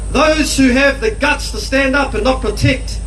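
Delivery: ordinary delivery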